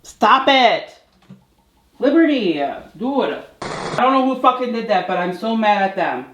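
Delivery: high, loud angry scolding; real fury at the cats for interrupting; angry yell. Real, not acted. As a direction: vent at the cats in real anger. Yelling at cats mid-video.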